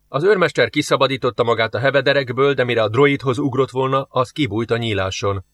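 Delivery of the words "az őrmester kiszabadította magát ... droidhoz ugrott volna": ordinary delivery, nothing marked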